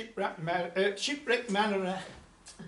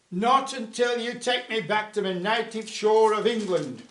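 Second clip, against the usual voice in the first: louder defiant call; shouted reply; 3 semitones higher, 7 dB louder than usual. Acted. Mariner shouting up from the whale's stomach, defiant. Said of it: "the mariner shouted back".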